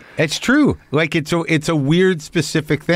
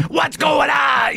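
high, slow, strained cartoon snake; 14 semitones higher, much slower than usual. Voice actor replays his snake role, straining hard. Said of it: "I'm straining my voice. I'm the snake".

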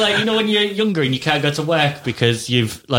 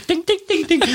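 very high sung dings; ping out each ding like a toy keyboard; keyboard ding imitation; faster than usual. Imitating Casio keyboard notes.

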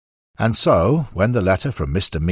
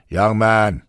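a lightly voiced brusque Mee; curt and stiff, talking down to a young man; 3 semitones higher, 4 dB louder than usual. A narrator's reading. Mee brushes off Taylor's loan request, lightly brusque. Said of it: "said Mee brusquely".

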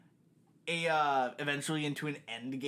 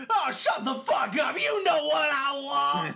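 higher, louder, slow shouted snap; mock shouted order; 11 semitones higher, 5 dB louder, slower than usual. Customer shouting at the drive-through speaker, slow mock yell. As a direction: yell it like an annoyed customer who thinks they're owed. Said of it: "And you go".

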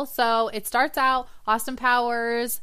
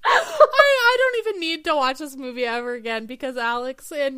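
laughing; 4 semitones higher, 4 dB louder than usual. Real, not acted. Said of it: "I can't even breathe".

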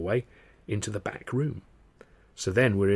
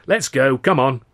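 impatient; 5 semitones higher, 11 dB louder than usual. Acted. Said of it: "starts getting up from his seat".

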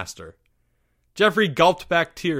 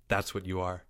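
low, soft pronouncement; cold verdict; 7 semitones lower, 11 dB quieter than usual. Acted. Leo answering Porge's cry, low quiet verdict. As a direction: pass judgement quietly.

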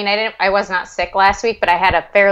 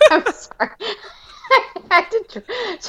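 laughing; 12 semitones higher than usual. Real, not acted. Still laughing at pug.

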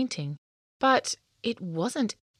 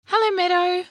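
high, loud greeting call; 12 semitones higher, 6 dB louder than usual. A narrator's reading. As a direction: call out across the garden. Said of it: "Sparkle called out".

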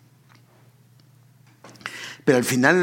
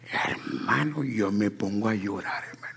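retold weeping whisper; 5 semitones lower, 8 dB quieter than usual. Retells crying over rejection.

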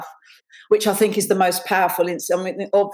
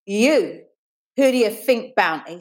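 mildly acted schoolgirl venom, raised at first; spat out; 3 semitones higher than usual. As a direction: spit it at a rival's face. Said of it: "spat Dawn".

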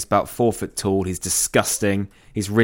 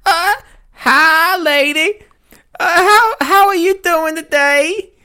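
much higher, louder squeaky goblin-ish voice; Randy McMatheson voice; 6 dB louder, slower than usual. Acted. D and D character Randy greeting the old lady, much higher. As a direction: pitch way up as a tiny creep. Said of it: "Can I do it as Randy McMatheson?".

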